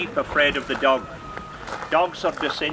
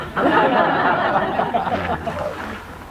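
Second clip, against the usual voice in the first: laughing; 6 semitones higher than usual. Real, not acted. Laughs at own joke.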